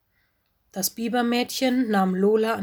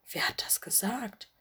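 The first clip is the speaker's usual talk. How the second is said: soft unvoiced whisper; hushed puzzled thought; whispered wonder; 8 dB quieter than usual. A narrator's reading. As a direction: whisper it to yourself, startled. Mino's startled inner question.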